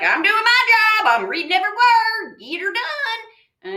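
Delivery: high, hammed-up eager worker; loud cartoonish go-getter; reading voice. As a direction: belt it out high, stretching the end. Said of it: "the reading voice is like".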